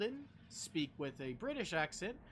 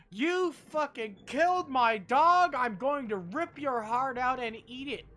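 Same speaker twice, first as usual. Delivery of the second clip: roaring with rage, slow and much louder; a higher, furious yell of a murderous threat; furious shout; 7 semitones higher, 12 dB louder than usual. Retold or imitated. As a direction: scream it like she'll rip their heart out. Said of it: "suddenly she looks like".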